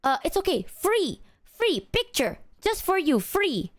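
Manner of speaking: high, coaxing sing-song imitation of a street photographer; mock eager tout pushing a free picture; imitating the pushy photographer. Stranger insisting the tourist take a free picture, high coaxing mimicry. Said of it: "And they're like".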